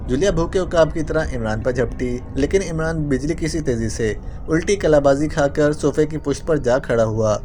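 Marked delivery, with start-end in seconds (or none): none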